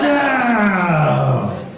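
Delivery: long, high, loud wail; shouted no. Acted. Speaker smashes the fantasy of a grateful daughter, shouted no. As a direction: burst out in a drawn-out falling yell. Breaks off the mock gratitude.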